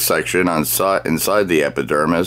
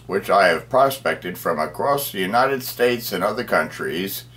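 usual speaking voice

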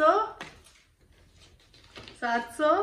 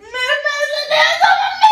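loud, high tearful yell; crying shout; 11 semitones higher, 8 dB louder than usual. Real, not acted. Daughter crying at mom to return the money, loud tearful wail.

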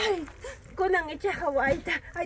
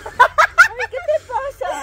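laughing through pain; 11 semitones higher, 6 dB louder, much slower than usual. Acted. Laughs while crying out.

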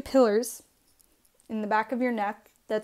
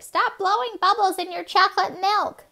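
high scolding mom voice; nagging mom voice; 10 semitones higher, 4 dB louder than usual. Retold or imitated. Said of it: "my mom was always like".

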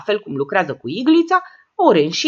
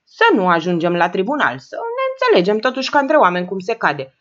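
ordinary delivery, nothing marked

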